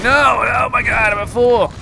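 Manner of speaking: high, loud wail of despair; despairing shout. Retold or imitated. Player wailing after falling off. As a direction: shout at your own mistake. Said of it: "I'm like".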